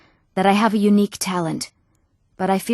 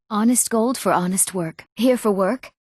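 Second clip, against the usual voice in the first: normal talking voice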